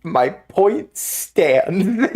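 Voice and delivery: drawn-out, deliberate, my point stands; very slow insist. Acted. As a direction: stretch each word out smugly. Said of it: "insisting jokingly".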